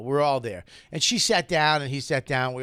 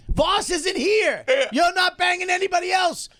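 shouted verdict; 13 semitones higher, 5 dB louder than usual. Acted. Heated argument over table rules.

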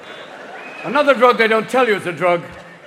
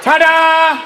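high, loud showman's flourish; triumphant ta-da; 9 semitones higher, 8 dB louder than usual. Acted. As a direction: sing it out like a big reveal. Triumphant punchline.

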